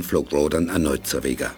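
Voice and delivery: ordinary talk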